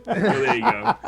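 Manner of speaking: high, loud laughing remark. Real, not acted. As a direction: concede it through real laughter. Teller laughing after failed joke.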